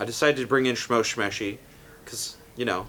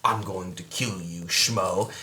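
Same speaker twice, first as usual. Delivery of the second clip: lower, softer threat; menacing stepbrother; 8 semitones lower, 5 dB quieter, slower than usual. Acted. Stepbrother threatening his father, low and soft. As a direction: murmur the threat darkly. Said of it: "and says".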